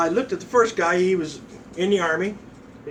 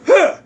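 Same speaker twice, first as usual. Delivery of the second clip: very loud, high "huh"; explosive high yelp; startled grunt; 12 semitones higher, 10 dB louder than usual. Retold or imitated. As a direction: grunt as if just struck. Recruit's grunt when hit.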